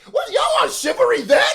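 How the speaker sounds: high, loud disbelief; incredulous outburst. Real, not acted. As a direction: shriek it as an outraged challenge. Mocks the chivalry objection.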